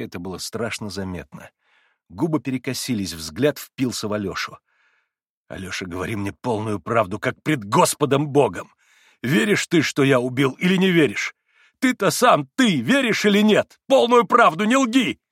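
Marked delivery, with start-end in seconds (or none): 5.5-15.2 всё выше и громче, до крика; кричать в исступлении, нарастая; исступлённый крик. A narrator's reading. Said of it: "крикнул он ему иступлённо". (Митя в исступлении кричит на Алёшу)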